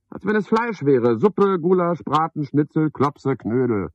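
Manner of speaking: mock-outraged tirade; scornful outburst. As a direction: rattle off the dishes with disgust. Scornful listing of meat dishes.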